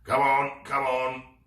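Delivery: loud, impatient pirate growl. Acted. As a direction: snarl it, tired of waiting. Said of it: "growled Captain Claw impatiently".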